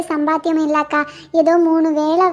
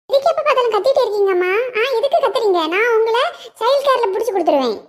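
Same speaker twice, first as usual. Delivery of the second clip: bratty; 5 semitones higher, faster than usual. Acted. Daughter shouting back at mother.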